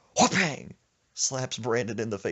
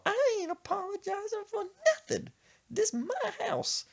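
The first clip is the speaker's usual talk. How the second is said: stubborn grandma voice. Retold or imitated. Grandma refusing to apologize to Brandon, high and defiant.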